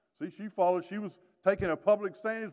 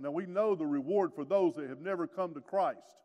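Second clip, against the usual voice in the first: normal talking voice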